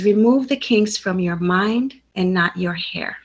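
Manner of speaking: usual speaking voice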